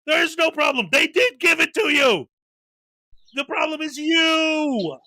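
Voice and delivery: high, shouted rebuttal; exasperated retort. Real, not acted. Host answering the auditor directly, exasperated. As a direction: call it out high and indignant.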